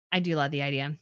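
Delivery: quiet dreamy repeat. Real, not acted.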